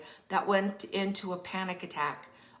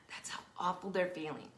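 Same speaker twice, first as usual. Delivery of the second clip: quieter whisper, stress on they're; 7 dB quieter, faster than usual. Real, not acted. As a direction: hush it, landing on they're. Repeats point in a whisper.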